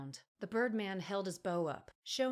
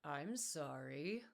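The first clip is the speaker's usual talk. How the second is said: lower, much softer, slow sarcastic apology; 3 semitones lower, 7 dB quieter, much slower than usual. A narrator's reading. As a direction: drip sarcasm, barely bothering. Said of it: "he said sarcastically".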